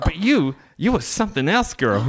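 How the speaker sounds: retold admiring shopworker. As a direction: playfully mimic her gushing compliment. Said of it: "she's like".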